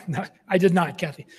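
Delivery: usual speaking voice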